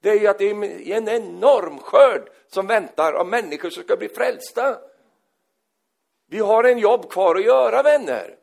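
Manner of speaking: loud, high preaching voice. Acted. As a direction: predikant i full kraft, hög och stark röst. Preacher at full force. (predikar högt och kraftfullt)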